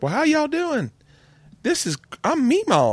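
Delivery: very high Southern grandma impression; Southern accent. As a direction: imitate a Southern meemaw announcing herself, way up high. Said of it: "have a Southern accent".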